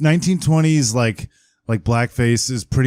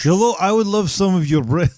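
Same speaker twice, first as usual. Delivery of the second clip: lightly put-on Scottish accent, higher; 5 semitones higher than usual. Friend voices a foreign bread customer, light Scottish accent. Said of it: "That is from Scotland".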